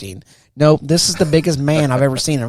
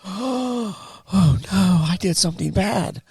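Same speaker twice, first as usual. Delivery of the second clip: high, hammed-up dismay; mock dread; 6 semitones higher, slower than usual. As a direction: play a recruit realising he is in trouble. Recruit realises he is in trouble.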